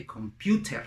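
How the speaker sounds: "computer" with a hard t and pronounced final r; non-native pronunciation. Shown as a demonstration. Teacher shows what English speakers don't say, hard-t pronunciation. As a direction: say "computer" com-PYOO-tehr, every letter sounded. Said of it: "they usually don't say".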